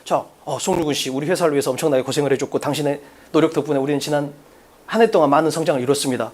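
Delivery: low, subdued formal company boss; boss in salary talk. Acted. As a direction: drop low and thank the employee formally. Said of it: "내가 연봉 협상을 해".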